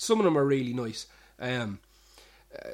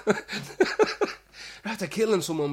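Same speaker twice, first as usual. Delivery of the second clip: slow, much higher, half-laughed; laughing quote; 8 semitones higher, 5 dB louder, much slower than usual. Retold or imitated. Barman quoting the regular's friend, laughing and slow. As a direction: drag it out while cracking up. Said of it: "And he goes".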